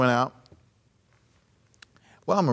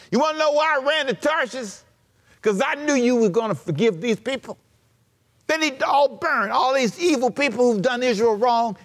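higher, whiny indignant complaint; indignant Jonah; 6 semitones higher than usual. Retold or imitated. Jonah complaining to God that he knew God would forgive. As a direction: pitch it up like a sulking prophet.

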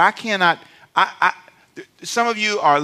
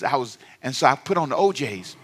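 ordinary talk, nothing marked

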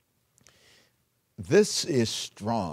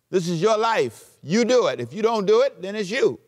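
preaching; 6 semitones higher than usual. Acted. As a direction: build it up to a charge. Preaching at full force.

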